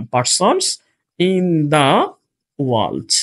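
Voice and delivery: PERSONS, IN THE, world in separate chunks; drawn-out "Persons in the", pause, world dropped low; slow dictation. Shown as a demonstration. Dictates words while placing them.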